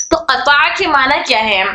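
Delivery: usual speaking voice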